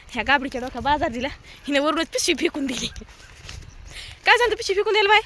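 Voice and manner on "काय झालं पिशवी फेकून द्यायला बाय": sharp, higher and louder telling-off; scolding. Real, not acted. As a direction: scold the niece for throwing the bag. Aunt scolds niece over bag. (रागाने ओरडून विचारणे)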